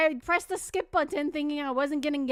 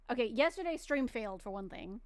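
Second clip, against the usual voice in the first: ordinary talk, nothing marked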